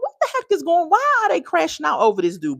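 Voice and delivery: high-pitched incredulous outburst; baffled disbelief. Acted. Acts out own baffled reaction.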